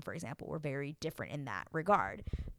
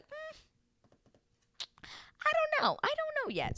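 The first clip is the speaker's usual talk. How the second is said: much higher, coy hesitant voice; mock Stacy, hesitant. Stacy answering Izzy, coy and unsure in a high voice. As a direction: play her as noncommittal and airy. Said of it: "Stacy's like".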